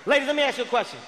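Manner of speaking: ordinary talk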